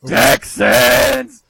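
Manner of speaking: booming, high-pitched PA announcer yell; stadium announcer shout. Retold or imitated. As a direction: imitate a stadium announcer firing up the crowd. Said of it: "the guy in the loudspeaker just goes".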